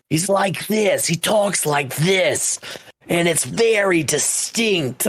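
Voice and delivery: put-on high, slow, light Minnesotan accent; Mike Lindell Minnesotan accent. Describing the villain's voice, light Minnesotan accent. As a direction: drawl it out in a hint of Minnesotan accent. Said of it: "a Mike Lindell accent, like, some, like, Minnesotan".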